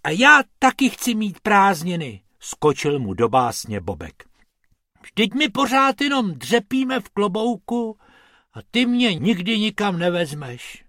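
high-pitched plaintive complaint; nearly crying. A narrator's reading. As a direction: whine like someone close to tears. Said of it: "Málem se rozplakal".

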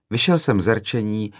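ordinary delivery

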